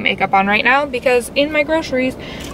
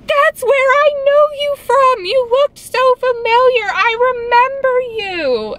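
imitating the guy, very high and slow, drawn-out delight; hammed-up eager fan, stretching every word; retelling how the pet store guy recognized her; excited guy; 4 dB louder, slower than usual. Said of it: "He goes".

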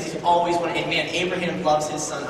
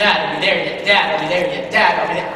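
put-on loud, insistent child nagging without a break; pestering kid; 7 dB louder than usual. Boy badgering his father hours into the walk, pestering kid. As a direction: pester louder, hammering each repeat. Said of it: "A couple more hours pass".

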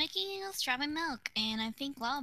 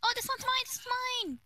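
excited squeal; 11 semitones higher, faster than usual. Real, not acted. Excited claiming own build.

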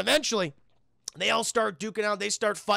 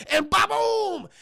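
high, loud ba-boom blast; cartoonish explosion noise; imitated explosion; 11 semitones higher, 4 dB louder, much slower than usual. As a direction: mimic the ship blowing up. Explosion sound effect imitated.

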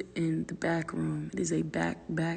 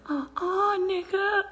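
much higher, sassy scolding of the dog; mock scolding; 13 semitones higher, slower than usual. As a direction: tell the dog off in a high sassy voice. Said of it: "I was cursing him out".

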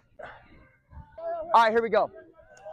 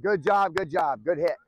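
usual speaking voice

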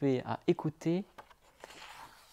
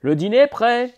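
loud, higher call for dinner; 8 semitones higher, 9 dB louder than usual. A narrator's reading. Father calling Poco for dinner, loud. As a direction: call it out to the woods. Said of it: "appela son père".

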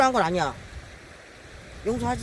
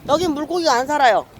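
higher, louder fed-up announcement; exasperated declaration; 7 semitones higher, 4 dB louder than usual. Real, not acted. Angler telling viewers no fish here, exasperated declaration.

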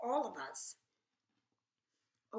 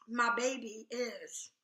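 ordinary delivery

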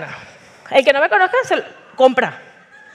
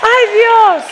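real startled cry; 8 semitones higher, 6 dB louder than usual. Real, not acted. Startled by something in the room.

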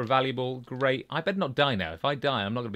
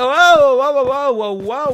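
loud, drawn-out startled whoas; 7 semitones higher, 10 dB louder, slower than usual. Real, not acted. Gamer startled by a sudden mob, loud whoas. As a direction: jump back in surprise, loud and slow.

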